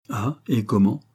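plain reading voice

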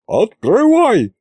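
high, loud, slow "Открывай" with a falling end; shouting at the locked door, drawn-out "Открывай" falling; yelled command. Acted. She pounds on the door.

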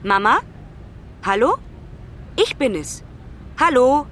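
short questioning phone hellos; calling down the phone. Acted. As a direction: call out to be heard on the phone. Calling mother over a phone line.

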